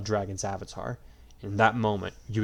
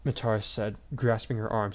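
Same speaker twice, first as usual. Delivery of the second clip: ordinary delivery, nothing marked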